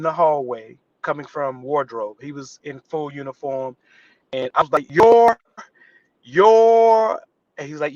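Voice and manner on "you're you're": high, loud flustered stammer; starstruck stammer. Retold or imitated. Starstruck extra stammering on meeting Chris Evans. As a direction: lose your words in awe.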